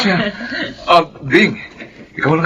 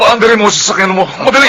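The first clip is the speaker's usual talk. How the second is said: louder, fast urgent orders; urgent command; 5 semitones higher, 4 dB louder, faster than usual. Acted. Husband ordering the car started for the baby, urgent. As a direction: hurry them to the car.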